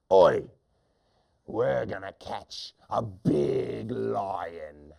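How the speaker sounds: slow, drawn-out mean hunter voice. Acted. Hunter announcing the plan to his friend, slow mean drawl. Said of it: "this is how they sound".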